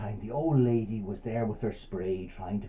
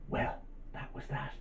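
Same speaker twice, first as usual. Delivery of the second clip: plain reading voice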